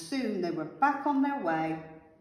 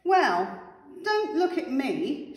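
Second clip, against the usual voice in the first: loud, higher, slow refusal; villain's shout; 5 semitones higher, 6 dB louder, slower than usual. A narrator's reading. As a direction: shout it like a selfish little villain. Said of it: "shouted the pea".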